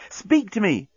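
high-pitched urgent plea. A narrator's reading. Nettie pleading with the silent bomb, urgent. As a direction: urge a reply out of them. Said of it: "note of urgency had crept".

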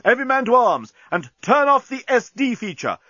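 high, ringing battle command; rally the troops at a high-pitched yell; yelled orders. A narrator's reading. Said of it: "yelled Bolfas".

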